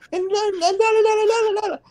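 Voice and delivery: high squeaky la la la; mock teacher babble. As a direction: parody her nagging as babble. Mocking teacher's rambling.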